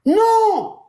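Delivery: sharp cry of no. Acted. Wife refusing her husband mid-quarrel, sharp cry. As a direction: fling out a refusal.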